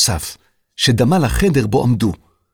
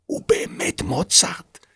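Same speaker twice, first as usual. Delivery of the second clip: awed whisper; 7 semitones higher, 5 dB quieter, slower than usual. A narrator's reading. Girl realizes the truth.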